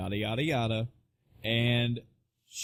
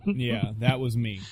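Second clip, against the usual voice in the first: ordinary talk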